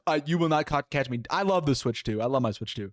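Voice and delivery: normal talking voice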